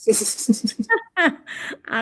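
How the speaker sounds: real chuckle between sentences; brief laugh trailing downward; light laugh. Real, not acted. Chuckles during self-introduction.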